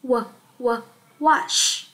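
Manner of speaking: "w, w, wash" built from the first sound. Shown as a demonstration. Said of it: "Chúng ta sẽ đọc là". (đánh vần "w, w, wash")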